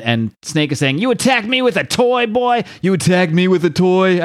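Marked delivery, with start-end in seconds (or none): 1.0-2.6 high-pitched put-on villain voice; King Snake. Villain to Robin, who struck with a staff, high put-on voice. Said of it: "what voice is supposed to do for King Snake". 2.8-4.2 raised, put-on villain voice; King Snake. Villain repeating his taunt to Robin, higher put-on voice. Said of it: "what voice is supposed to do for King Snake".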